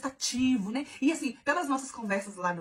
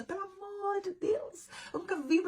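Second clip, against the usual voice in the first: much higher, very slow whining plea; exasperated; 9 semitones higher, slower than usual. Real, not acted. Outraged at her date calling it childish, slow high whine. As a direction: drag it out in disbelief.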